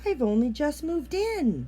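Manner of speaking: a frog protesting as he is carried off; upset frog. A narrator's reading. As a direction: protest in dismay. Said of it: "he croaked".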